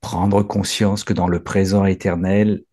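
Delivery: low, hushed prayer of praise; low murmured devotional prayer. Acted.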